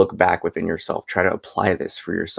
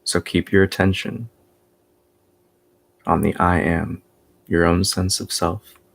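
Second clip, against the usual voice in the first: guided meditation; 2 semitones higher than usual. Real, not acted. Teacher guiding listeners after three oms, guided meditation voice. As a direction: lead them into stillness, one unhurried phrase at a time.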